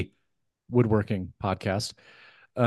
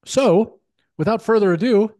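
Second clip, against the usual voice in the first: hyped giveaway announcement; 6 semitones higher, 5 dB louder than usual. Real, not acted.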